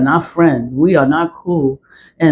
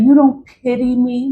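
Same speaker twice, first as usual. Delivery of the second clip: higher-pitched mocking plea; mock crying; 5 semitones higher than usual. Woman repeating her manipulative man's plea, mock crying. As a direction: imitate his tearful begging, scornfully. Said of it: "That's what he said".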